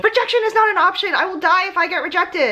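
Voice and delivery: high, dramatic mock despair; hammed-up panic; mock panicked pre-dent. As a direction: play the frantic applicant. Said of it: "you pre-dents out there right now thinking".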